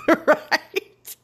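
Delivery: laughing right up high; giggling tag question. Real, not acted. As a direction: laugh the word out. Laughs at yelling back.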